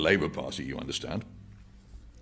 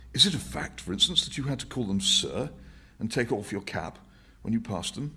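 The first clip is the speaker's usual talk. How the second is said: higher, earnest and persuasive; Winston's voice; 11 semitones higher, 6 dB louder than usual. Acted. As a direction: press the point to the old man.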